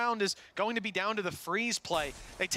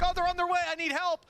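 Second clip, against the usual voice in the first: imitating a panicked callout; 10 semitones higher, 3 dB louder than usual. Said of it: "call, like".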